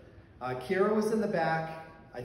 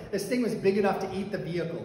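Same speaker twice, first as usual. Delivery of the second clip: ordinary delivery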